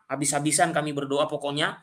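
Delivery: ordinary delivery, nothing marked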